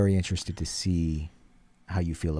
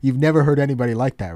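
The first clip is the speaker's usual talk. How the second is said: excited; 7 semitones higher, 10 dB louder, faster than usual. Real, not acted. Hyping up the singer.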